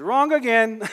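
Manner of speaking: loud, high mock exclamation; Rafiki. Pastor voicing the mentor correcting Simba, loud and high. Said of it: "I may mess up the accent".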